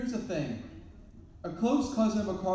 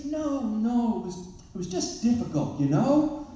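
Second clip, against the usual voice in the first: contrarian correction; 7 semitones higher, 4 dB louder than usual. Retold or imitated. Oppositional man correcting him.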